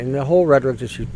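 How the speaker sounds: ordinary delivery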